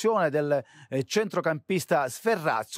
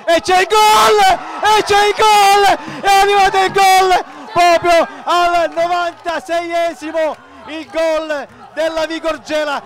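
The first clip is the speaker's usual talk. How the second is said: much higher and louder goal shout; breathless italian goal commentary; 9 semitones higher, 11 dB louder than usual. Acted. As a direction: scream the winning goal. Last-minute winning goal call.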